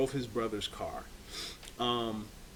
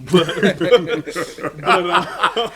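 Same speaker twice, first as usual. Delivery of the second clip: big high burst of laughter; loud laughter; 7 semitones higher, 14 dB louder, much slower than usual. Real, not acted. Laughing at friend's dating.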